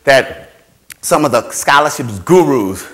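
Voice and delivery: ordinary talk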